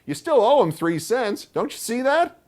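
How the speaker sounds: put-on very high, loud boyish whine; a kid protesting to his aunt; complaining boy. A narrator's reading. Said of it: "I complained".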